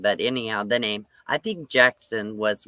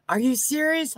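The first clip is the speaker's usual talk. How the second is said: mock shock; 14 semitones higher than usual. Guy's shocked question retold.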